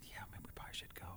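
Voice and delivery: fast, hushed whisper; quick nervous whisper. Retold or imitated. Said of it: "Why are we whispering?".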